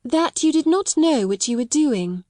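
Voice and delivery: proud and courteous, chin up; grandly and politely. Acted. Sarah to Miss Minchin, grand and polite. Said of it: "she answered grandly and politely".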